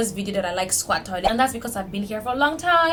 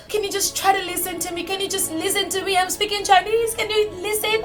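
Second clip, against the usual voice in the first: higher, sighing exasperated plea; mock pleading; 8 semitones higher than usual. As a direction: beg them to just listen. Pleading with locals to listen.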